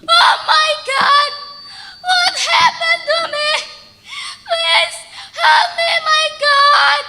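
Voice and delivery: broken mother wailing to God on the ground; crying out loud. Acted. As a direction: sob and shout for help. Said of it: "Malin's mother cried loudly".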